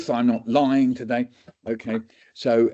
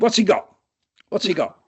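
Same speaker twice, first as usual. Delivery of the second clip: much higher, louder teacherly challenge; quizzing doctor; 10 semitones higher, 3 dB louder than usual. Retold or imitated. Doctor grills students, high and loud. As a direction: fire the question at the class.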